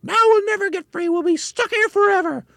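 very high, loud despairing screech; screaming. A narrator's reading. Mrs Twit realises they are stuck fast, screaming. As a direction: shriek it in despair. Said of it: "screamed Missus Twit".

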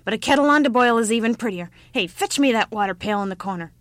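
put-on brisk, cross old-woman orders; grumpy old woman. A narrator's reading. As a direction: boss the child about crossly. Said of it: "grumped the old woman".

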